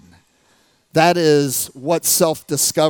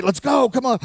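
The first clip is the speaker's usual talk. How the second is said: high, pushy coach hurry-up; 9 semitones higher, 3 dB louder than usual. Retold or imitated. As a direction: urge the kid on like a coach. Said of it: "that's the coach in me".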